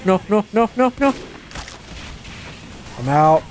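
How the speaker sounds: usual speaking voice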